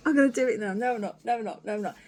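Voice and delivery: ordinary talk, nothing marked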